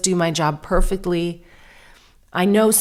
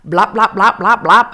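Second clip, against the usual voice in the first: loud playful blop blop sounds, like bashing keys; a lightly voiced cartoon typing noise; mock keyboard noises; 3 semitones higher, 7 dB louder than usual. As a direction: bash out silly typing noises. Mimicking keyboard pounding sounds.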